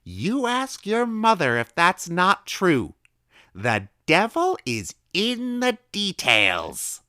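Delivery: very high, slow, drawn-out proclamation; gleeful secret. A narrator's reading. Old woman gleefully telling the boy a secret. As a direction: savour it like a secret.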